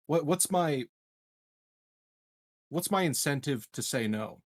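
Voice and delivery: usual speaking voice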